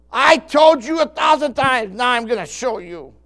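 a loud, slow, scolding mock outburst; exasperated parent. Preacher mimicking an angry parent, loud scolding. Parent voiced losing temper.

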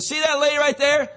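loud parent call. Retold or imitated. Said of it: "Mom and Dad said".

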